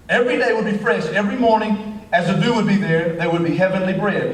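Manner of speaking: usual speaking voice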